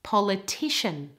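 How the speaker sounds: "Politician" with stress on the third part; third-syllable stress. Shown as a demonstration. Teacher shows the stress moving, third-syllable stress on "Politician". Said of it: "stress moves to the third part".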